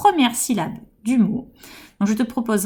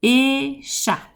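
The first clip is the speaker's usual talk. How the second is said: "écharpe" with a long held é; "écharpe" split after a stretched é; stressed first syllable; 3 semitones higher than usual. Shown as a demonstration. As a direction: lean on the opening é of "écharpe". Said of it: "On va accentuer la première syllabe".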